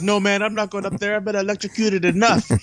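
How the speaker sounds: high mock protest from a reluctant sidekick; protesting cartoon character. Tito refusing Dodger's order to climb, protesting.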